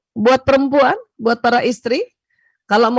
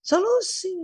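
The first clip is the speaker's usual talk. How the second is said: soft echo; 6 semitones higher, 6 dB quieter, slower than usual. Shown as a demonstration. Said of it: "solusi bukan?".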